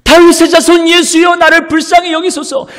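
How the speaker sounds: very high, loud unbroken plea; insistent crying out. Acted. Blind beggar calling on the Lord over the scolding, shouting. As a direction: keep calling, straining to be heard. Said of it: "계속 주님의 이름을 부르는 것이에요".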